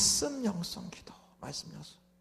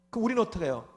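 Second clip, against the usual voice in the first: usual speaking voice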